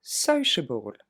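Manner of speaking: Sociable said very slowly, able unstressed; slow model word. Shown as a demonstration. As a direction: drag sociable out, weak ending. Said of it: "Encore une fois". (Sociable très lentement, fin non accentuée)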